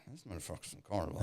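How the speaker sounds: low, hushed aside to himself; private thought. Retold or imitated. Storyteller to himself outside the coffee shop, hushed private thought. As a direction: keep it very quiet and low, under your breath.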